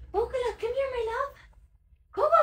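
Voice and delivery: high sing-song dog call. Real, not acted. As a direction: coax the puppy over sweetly. Calling the dog sweetly.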